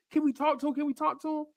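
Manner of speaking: high, small imitated child voice; pleading little kid. Imitating the kid asking his pops, high pleading. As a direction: beg softly like a starstruck little boy.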